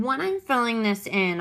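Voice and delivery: ordinary talk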